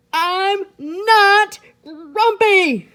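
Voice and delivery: very high, loud, slow shout. Acted. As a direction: bellow it out in fury. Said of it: "shouted Jim".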